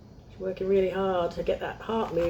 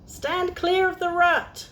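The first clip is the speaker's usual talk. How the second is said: high, slow squirrel voice giving orders; squirrel instructing; 10 semitones higher, slower than usual. Acted. A squirrel instructs Olive, slow high commanding voice. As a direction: lay down the rule slowly, up high. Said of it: "instructed a squirrel".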